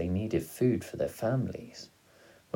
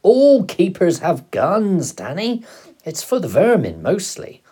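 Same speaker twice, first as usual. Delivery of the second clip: excited father; 12 semitones higher, 12 dB louder than usual. A narrator's reading. Father excitedly answers shocked son.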